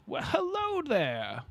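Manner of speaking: slow, high, hammed-up British "hello there"; a deliberately bad British gent greeting; British accent. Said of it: "I don't even know how to pretend to be British".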